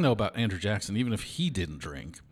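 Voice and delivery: ordinary delivery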